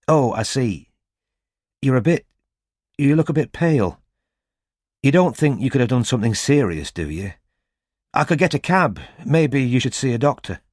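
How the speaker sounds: concerned woman voice. A narrator's reading. Robin voiced.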